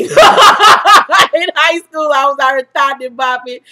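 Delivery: high, loud, slow laugh through the words. Real, not acted. Confessing her wild high school days to viewers, laughing. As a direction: giggle out the confession.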